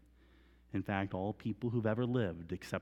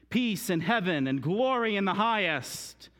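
loud, high, ringing proclamation; crowd's acclamation; 8 semitones higher, 6 dB louder than usual. Acted. Preacher voicing the people greeting their King, loud and high. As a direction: cry it out like a welcoming crowd. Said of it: "the people were saying".

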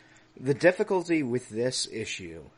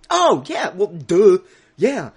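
sarcastic duh; 9 semitones higher, 12 dB louder than usual. Real, not acted. Sarcastic obvious agreement.

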